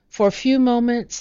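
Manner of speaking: usual speaking voice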